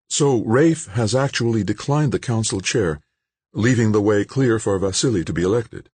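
a hint of Jane, briefing him; Jane's voice.

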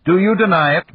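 snapped. A narrator's reading. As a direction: snap the question at him. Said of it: "Malik snapped".

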